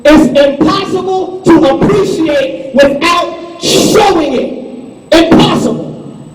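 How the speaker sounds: slow, loud preaching cadence, each word driven home. Acted. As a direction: hammer the truth to the congregation. Preaching at full force.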